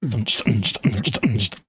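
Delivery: mouthed dance-beat noises; beatbox a thumping club beat. Pretend. Host working at the bench, beatboxing. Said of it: "Beatboxing, folks. He's beatboxing".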